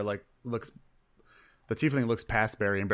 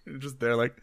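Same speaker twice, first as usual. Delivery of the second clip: normal talking voice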